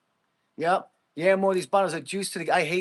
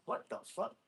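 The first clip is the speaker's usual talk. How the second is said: under-the-breath what the fuck, much softer; whispered disbelief; 12 dB quieter than usual. Real, not acted. Streamer stunned by a weak lift, whispered disbelief.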